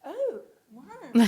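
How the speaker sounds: loud, fascinated awe. Retold or imitated. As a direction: draw it out in wonder.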